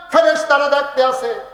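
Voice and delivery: loud, high sermon cry; full-force preaching. Real, not acted. Preacher telling of angels calling out, loud high cry. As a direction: proclaim it at the top of the voice.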